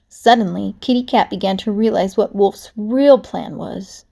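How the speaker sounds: plain reading voice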